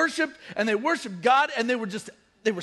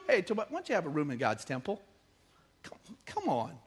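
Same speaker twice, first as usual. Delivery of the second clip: mock invitation; 6 semitones lower, 9 dB quieter than usual. Mocks the priest inviting Tobiah.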